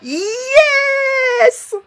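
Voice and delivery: long, high-pitched squeal of victory; triumphant yes. Real, not acted. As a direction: stretch out a high, jubilant cheer. Celebrates the boss's defeat. (cri de victoire aigu et étiré)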